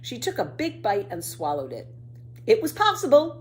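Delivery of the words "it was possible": high and loud triumph; delighted announcement. A narrator's reading. Pippi after eating the mushroom, delighted announcement. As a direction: announce it like a proud discovery. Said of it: "she announced, delighted".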